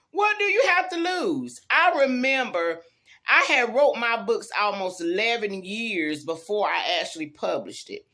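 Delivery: ordinary talk, nothing marked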